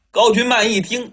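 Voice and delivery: ordinary delivery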